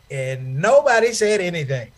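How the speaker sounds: ordinary talk, nothing marked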